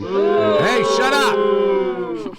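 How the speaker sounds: loud, high shout at the crowd; mock angry yell. Host silencing the paid audience, loud mock scolding.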